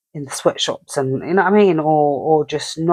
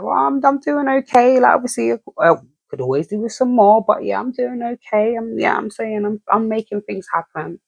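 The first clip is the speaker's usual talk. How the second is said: content person voice; 7 semitones higher, faster than usual. Retold or imitated. Quoting people who are doing okay.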